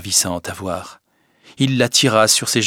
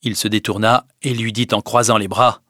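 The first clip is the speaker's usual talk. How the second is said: ordinary delivery, nothing marked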